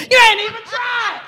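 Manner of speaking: usual speaking voice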